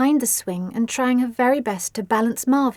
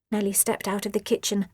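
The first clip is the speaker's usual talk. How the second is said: plain reading voice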